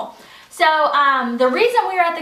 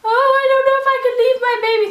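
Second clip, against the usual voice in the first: high, sing-song mock whining; hammed-up fretting parent, quick and high-pitched; mock mopey; 11 semitones higher, 4 dB louder, much faster than usual. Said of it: "mopey, like".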